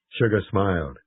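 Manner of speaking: ordinary delivery, nothing marked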